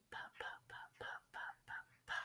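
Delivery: "ba" whispered as an unvoiced p. Shown as a demonstration. Teacher showing p as a whispered b. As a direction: breathe "ba" like a whispered pa. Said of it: "a whisper".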